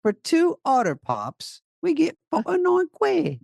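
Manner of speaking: high mock British rant; shrill-free high imitation of an angry girl. Man mocking the angry British girl's rant, high-pitched.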